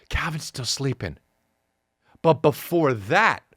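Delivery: ordinary talk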